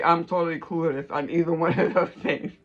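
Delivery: ordinary talk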